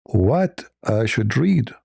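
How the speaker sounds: slow, bewildered asking; slow questioning. Retold or imitated. Host voicing Muhammad's bewildered question, slowly. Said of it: "I'm saying".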